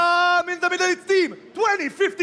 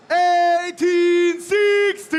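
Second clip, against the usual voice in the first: slow, stretched hype intro; slow announcer drawl; 3 semitones higher, 3 dB louder, much slower than usual. Acted. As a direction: drag each word out for the crowd. Announcing teams to the crowd.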